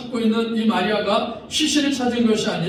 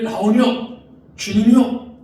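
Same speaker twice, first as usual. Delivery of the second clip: higher, louder cry of recognition; Mary's recognition; 3 semitones higher, 5 dB louder than usual. Acted. Mary recognizes Jesus, higher joyful cry. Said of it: "이렇게 부르는 거예요".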